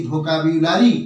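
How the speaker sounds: ভোকাবুলারি with a clear "byu" glide; careful "bhokabyulari" for ভোকাবুলারি; correct pronunciation. Shown as a demonstration. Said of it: "এর সঠিক উচ্চারণ হবে".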